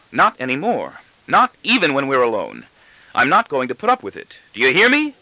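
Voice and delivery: wife laying down the law to her husband; vehement wife. A narrator's reading. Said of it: "my wife goes on vehemently".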